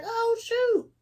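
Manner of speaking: squeaky high mock "oh shoot"; startled devil. The scared devil fleeing the sword, high squeak. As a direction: play the devil caught off guard, high-pitched. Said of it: "He was like".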